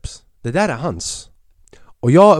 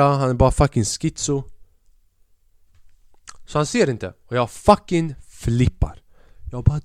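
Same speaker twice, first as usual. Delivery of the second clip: usual speaking voice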